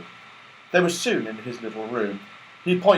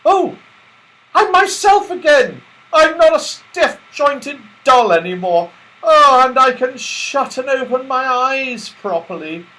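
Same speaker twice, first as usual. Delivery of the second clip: high, loud joyful relief; delighted Beth; 11 semitones higher, 9 dB louder than usual. A narrator's reading. Beth finding she is no longer a doll, overjoyed. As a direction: gush with happy relief.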